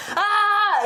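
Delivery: laughing high mock scream of the nurse; playful imitation of a delivery-room scream. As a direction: yelp it out half laughing, like a panicked helper. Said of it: "you guys were probably both screaming".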